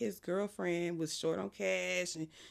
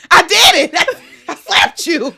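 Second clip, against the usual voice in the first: much higher, shouting through laughter; laughing shout; 10 semitones higher, 20 dB louder than usual. Retold or imitated. Partner proud of his slap, shouting through laughter. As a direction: shout it proudly while cracking up. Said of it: "And he is like".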